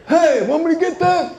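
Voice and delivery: high, cowboy-ish offer to pay; lightly voiced dad playing a Western hero; dad's signature move. Dad offering to pay the check, in a put-on Western voice. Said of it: "It's like he's in an old Western".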